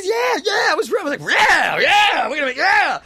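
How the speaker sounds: high, shouted, hyped-up yeahs; whooping agreement. Retold or imitated. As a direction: re-enact it as an over-the-top rowdy cheer. Acting out roaring drunken enthusiasm.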